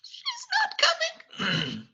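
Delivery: tiny high squeak, drawn out slowly; Cogsworth, very nervous. Acted. Nervous servant repeats bad news to Beast, timid squeak. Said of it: "very nervous looking".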